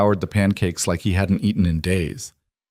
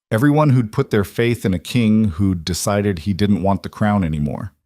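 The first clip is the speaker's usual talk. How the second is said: plain reading voice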